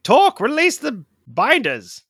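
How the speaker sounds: high, loud in-character demand; yelling. Acted. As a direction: keep yelling to a companion across the room. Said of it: "I'll just keep yelling".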